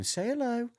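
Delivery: high singsong coaxing, imitated; cooing puppy owner.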